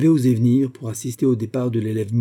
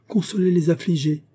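high-pitched call, only mildly raised in volume; 7 semitones higher than usual. A narrator's reading. Olga calling back down the corridor, a high cry. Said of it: "cria celle-ci dans le corridor".

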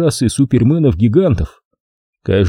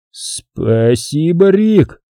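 lost mumble; 7 semitones higher, much slower than usual. A narrator's reading. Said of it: "потерянно пробормотал Пит".

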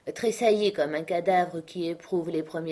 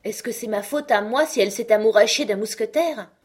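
louder, raised, exasperated uncle; indignant self-defence; 5 semitones higher, 6 dB louder than usual. A narrator's reading. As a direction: shrug off the blame.

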